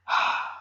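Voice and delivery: demonstrated weary sigh; long sigh. Shown as a demonstration. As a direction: let out one long, breathy sigh. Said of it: "you let out a long sigh".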